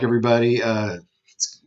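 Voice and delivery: usual speaking voice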